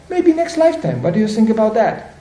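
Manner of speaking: suddenly high, teasing voice; mock tempting offer. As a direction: dangle it like a sweet deal. [name] tempting with another life.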